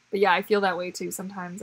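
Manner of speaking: ordinary talk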